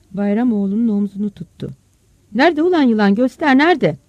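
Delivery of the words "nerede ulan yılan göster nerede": loud, high urgent questioning; urgent demand. Acted. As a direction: demand to be shown the danger. Father urgently demands snake's whereabouts. (telaşla, bağırarak sorma)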